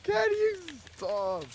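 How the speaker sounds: very high, drawn-out mimicry of a complaint; mocking echo. Friend echoing a player's shouted complaint, mocking high imitation. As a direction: stretch it out in a squeaky mock whine.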